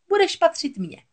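high-pitched possessive witch voice; gloating sea witch. A narrator's reading. Witch naming the forfeit to Ariel, gloating. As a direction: swoop up high and relish the threat. Witch states her price.

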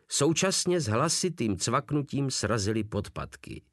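ordinary delivery, nothing marked

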